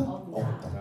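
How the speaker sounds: usual speaking voice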